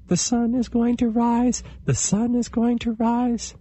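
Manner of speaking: very high squeaky chant; excited chipmunk voice. Acted. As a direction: pipe it up high and eager. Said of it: "Little Chipmunk next to him was saying".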